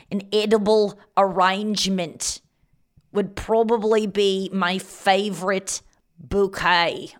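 slow, botched Australian; Australian accent. Pretend. Naming a preferred gift for Matt, badly faked Australian. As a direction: fumble through an exaggerated Australian accent. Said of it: "I'm fucking up".

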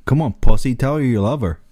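pushy mock taunt; mock taunting devil voice. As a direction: goad him like a devil on the shoulder. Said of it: "It's like".

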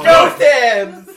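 gleeful shout through laughter; laughing. Pretend. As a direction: cackle it out with glee. Acting out ghost attack gleefully.

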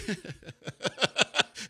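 high mock cackle; Kamala Harris. Host mimics vice president's laugh, high crazy cackle. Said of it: "It just goes nuts".

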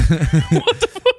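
drawn out through laughter; laughing. Real, not acted. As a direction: laugh the words out slowly. Friend's drunk chicken shop story.

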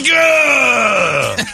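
loud high pleasure grunt; lusty grunt. Acted. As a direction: grunt as you grab. Groping grunt.

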